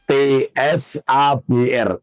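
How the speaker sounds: T S A B R spelt slowly, letter by letter; spelling it out. Shown as a demonstration. Preacher spelling the name for the audience, slow letters. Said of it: "Jadi pakai sa".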